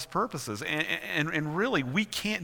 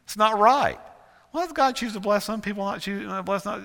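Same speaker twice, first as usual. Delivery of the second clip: high, protesting voice; indignant complaint; 9 semitones higher, 6 dB louder than usual. Acted. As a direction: protest the unfairness in a raised pitch. Mock complaint about God's unfairness.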